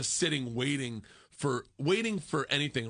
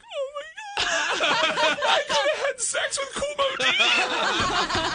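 very high, slow, laughing and near tears; high laughing wail of disbelief; laugh-crying; 6 dB louder, slower than usual. Real, not acted. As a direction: squeal it through helpless laughter.

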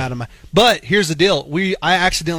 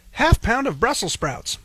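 put-on a light childlike pitch, a bit higher; imitating his son; 6 semitones higher than usual. Dad echoing his son ordering Brussels sprouts, lightly childlike. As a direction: copy the boy's voice gently. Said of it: "And my son, at that moment, goes".

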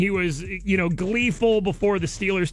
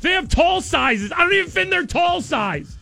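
incredulous outburst; 11 semitones higher than usual. Real, not acted. Disbelief at not fitting tall sizes.

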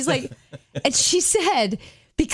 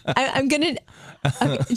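normal talking voice

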